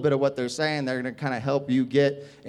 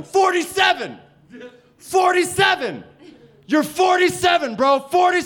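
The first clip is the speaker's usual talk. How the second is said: very high, loud repeated shouts; yelling heckler; 7 dB louder than usual. Retold or imitated. Kid keeps heckling the comedian about age, high yell. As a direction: imitate a kid hollering relentlessly. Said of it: "he's just yelling".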